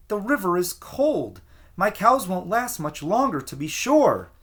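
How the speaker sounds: high, anxious little man pleading; worried plea. A narrator's reading. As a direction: press him, worried and high. Stranger pleads.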